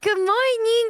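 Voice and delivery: chirpy greeting. Retold or imitated. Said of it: "she's like".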